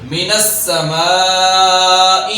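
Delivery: السماء with the meem drawn out long and the seen whistled; slow recited السماء with long madd. Shown as a demonstration. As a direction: stretch the meem of السماء for two counts. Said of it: "مد پیدا کریں گے".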